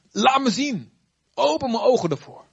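pleading cry. Acted. Moses pleading with God.

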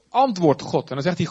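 ordinary talk, nothing marked

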